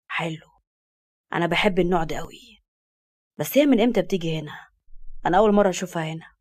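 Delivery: low smug male voice; cocky young man. Acted. Farid voiced.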